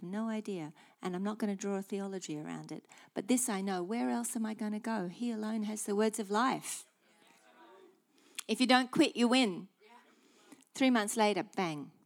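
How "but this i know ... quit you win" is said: ordinary talk, nothing marked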